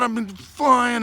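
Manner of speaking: usual speaking voice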